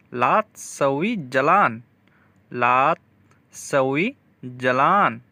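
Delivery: "ला सवी Jalan" drawn out syllable by syllable; very slow repeat. Shown as a demonstration. Teacher slowly spells out the Arabic for students. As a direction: break it down slowly for learners. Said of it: "slow teaching repeat".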